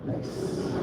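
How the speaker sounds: soft unvoiced relish; whispered nice. Cat voice savoring owner's sadness, soft whispered delight. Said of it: "Cats are like".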